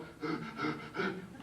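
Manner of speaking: imitated heartbeat in short, breathy thumps; pounding heart noise. Storyteller shows his heart after the fight, pounding noises. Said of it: "my heart's going".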